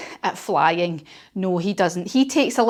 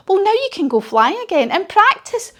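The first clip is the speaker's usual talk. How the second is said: put-on girl encouraging Zog; 9 semitones higher, 3 dB louder than usual. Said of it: "she says".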